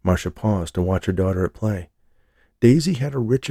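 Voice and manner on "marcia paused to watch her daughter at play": ordinary delivery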